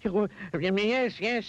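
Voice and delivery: high, flustered yes; hurried agreement. A narrator's reading. Mister Mean feeling his feet tingle, hurried. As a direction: give in hastily, pitched high. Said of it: "he said hurriedly".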